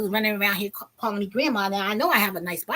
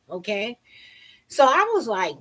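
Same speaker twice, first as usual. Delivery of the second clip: ordinary talk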